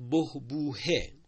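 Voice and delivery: بهبوهه slow and emphatic, every syllable held; slow, clear. Shown as a demonstration. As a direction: spell out "بهبوهه" slowly and loudly. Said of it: "با ه دو چشم بنویسیم یا ه جیمی".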